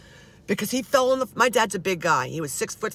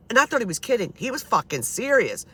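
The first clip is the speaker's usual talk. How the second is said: ordinary talk, nothing marked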